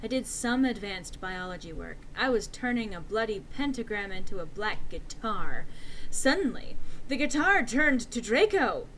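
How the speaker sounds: ordinary delivery, nothing marked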